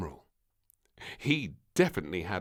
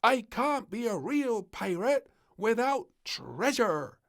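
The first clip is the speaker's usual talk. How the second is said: frowning complaint; 7 dB louder than usual. Acted. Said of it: "Little Princess frowned".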